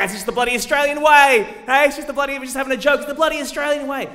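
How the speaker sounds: high, loud mocking ocker accent; broad Australian accent. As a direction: ham up a blokey Aussie excuse.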